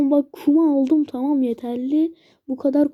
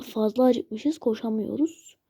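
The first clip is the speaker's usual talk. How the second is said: ordinary talk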